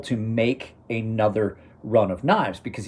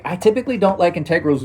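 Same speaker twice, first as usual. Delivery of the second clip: quoting himself; 6 semitones higher, 3 dB louder, faster than usual. Retold or imitated. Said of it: "I was like".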